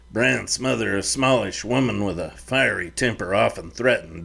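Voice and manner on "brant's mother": plain reading voice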